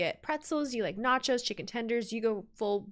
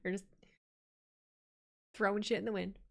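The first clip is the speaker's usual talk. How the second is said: ordinary talk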